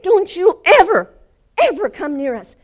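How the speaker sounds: very high, loud command; explosive, authoritative warning; furious shouting. Retold or imitated. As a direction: shout it down at a cowering enemy. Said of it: "I'm shouting at him".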